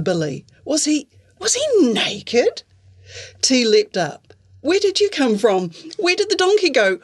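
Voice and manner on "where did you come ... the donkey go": high, alarmed questions; startled girl demanding answers. Acted. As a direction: fire them off in panic. T leaps up, startled.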